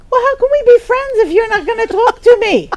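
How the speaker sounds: the new friend on the phone, hurt and protesting; hurt friend's complaint. Retold or imitated. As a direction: whine it like an offended new friend. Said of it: "And she says".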